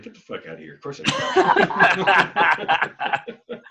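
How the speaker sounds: laughing through the line; a drawn-out laughing retort. Retold or imitated. Imitating Kim laughing off a question about swearing. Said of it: "And she laughs".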